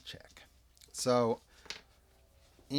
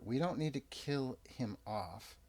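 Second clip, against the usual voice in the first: ordinary delivery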